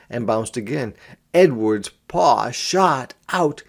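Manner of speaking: ordinary delivery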